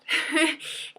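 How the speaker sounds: brief, self-amused laugh; a little burst of laughter; short laugh. Real, not acted. Laughing at own very sad day, short chuckle.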